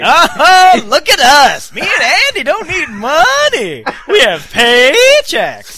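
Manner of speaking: high, loud, slow snooty voice; mocking snob. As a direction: lord it over everyone in a posh sneer. Mocking friends' boast.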